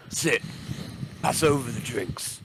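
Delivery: a hint of a gruff orc, slow on the name; lightly voiced orc captain, slow and sure; orc boss voice. Orc captain introduces himself, lightly voiced gruff orc. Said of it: "the orc says".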